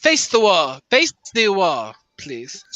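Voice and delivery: put-on clumsy German accent, dragged out; bad German accent. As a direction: attempt a German accent and miss. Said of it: "my German accent is terrible".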